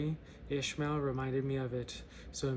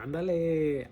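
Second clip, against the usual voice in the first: put-on louder stab at a Mexican accent; 2 semitones higher, 5 dB louder than usual. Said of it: "how do I do a Mexican accent?".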